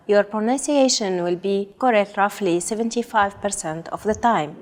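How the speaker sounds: ordinary talk, nothing marked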